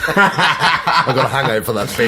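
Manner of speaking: high, loud and laughing; laughed-out, drawn-out quip. Real, not acted. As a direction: joke through your own laughter. Joking amid shared laughter.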